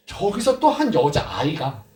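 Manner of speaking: usual speaking voice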